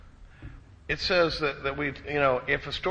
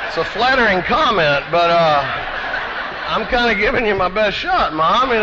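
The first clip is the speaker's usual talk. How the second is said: laughing protest; 6 semitones higher, 9 dB louder than usual. Retold or imitated. Answer to mom, laughing.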